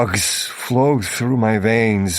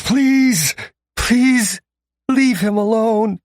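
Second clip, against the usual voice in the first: much higher, loud, desperate plea; begging woman; 11 semitones higher, 5 dB louder, much slower than usual. A narrator's reading. Bridget begging the captors, high and desperate. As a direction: beg them in a high frantic voice.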